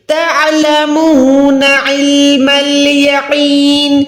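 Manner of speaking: علم with ain from the middle of the throat; slow recitation, clear ain in علم. Shown as a demonstration. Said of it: "عین کو ادا کرنا ہے پراپر بیچ سے".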